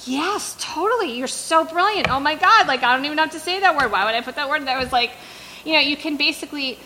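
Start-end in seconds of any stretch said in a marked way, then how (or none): 0.1-4.6 high, gushing mock praise; retelling her own eager yes-yes; gushing agreement. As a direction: fawn over the agent's brilliance. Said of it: "And I'm like".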